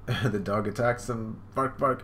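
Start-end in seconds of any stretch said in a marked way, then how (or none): none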